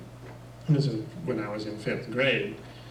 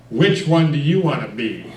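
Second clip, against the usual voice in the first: stern demand; 3 semitones higher, 9 dB louder than usual. Retold or imitated. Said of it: "And in this tone of voice".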